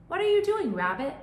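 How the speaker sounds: high loud call to a friend. Acted. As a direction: call out across the meadow. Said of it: "Mouse called".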